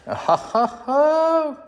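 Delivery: high, loud burst of laughter; gleeful laugh. Real, not acted. Builder celebrates working outlets, triumphant high laugh.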